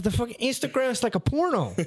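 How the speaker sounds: high accented imitation; imitating the Guatemalan coworker; coworker's voice. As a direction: mimic the coworker's broken English. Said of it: "he said".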